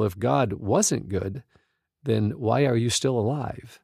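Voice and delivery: ordinary talk, nothing marked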